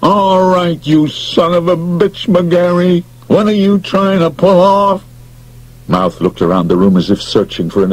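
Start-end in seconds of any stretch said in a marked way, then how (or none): 0.0-5.0 a touch of outrage; high angry demand. Acted. Beasley confronting McGarry after fainting, angry. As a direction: snap at him suspiciously.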